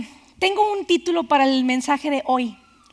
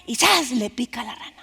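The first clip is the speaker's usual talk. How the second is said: ordinary talk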